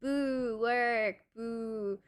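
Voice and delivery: normal talking voice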